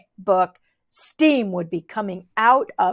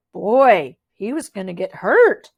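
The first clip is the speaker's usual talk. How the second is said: plain reading voice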